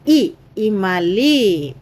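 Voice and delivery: ఈ then ఇమలి stretched very slowly; slow model word. Shown as a demonstration. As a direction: draw out ఇమలి for pupils to repeat. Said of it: "పలకండి, నాతో పాటు".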